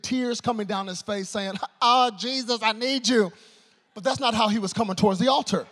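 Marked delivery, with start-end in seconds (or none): 1.8-3.3 lightly acted cry to Jesus, louder; pleading seeker. Young man coming to the altar, mildly pleading cry. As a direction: call out as an imagined altar seeker. Imagined tearful seeker crying out.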